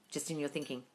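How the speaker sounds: normal talking voice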